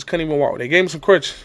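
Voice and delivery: usual speaking voice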